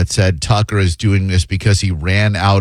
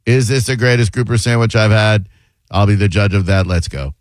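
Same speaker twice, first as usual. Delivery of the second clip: monotone impression. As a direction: imitate someone talking with no airs at all. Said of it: "fun of him for that monotone".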